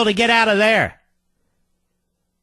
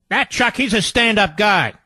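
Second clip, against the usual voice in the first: ordinary delivery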